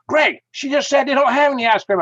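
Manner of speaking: high, loud mock protest; protesting friend. [name] protesting to Greg.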